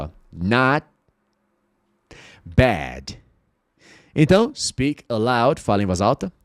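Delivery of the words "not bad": not bad with the T only hinted; tongue stays on the roof, T never released; unreleased t. Shown as a demonstration. Teacher showing a held T, careful. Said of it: "a língua fica no céu da boca".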